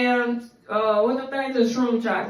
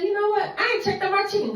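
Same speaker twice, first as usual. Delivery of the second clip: high inner voice; 9 semitones higher, faster than usual. Retold or imitated. Inner voice telling her to check.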